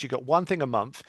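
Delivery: ordinary delivery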